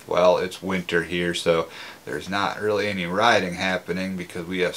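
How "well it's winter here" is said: ordinary delivery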